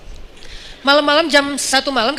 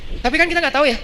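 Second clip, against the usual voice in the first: usual speaking voice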